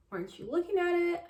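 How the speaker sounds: high, disbelieving question; can't believe she missed it; incredulous. Retold or imitated. Patient challenging the unsure doctor, incredulous.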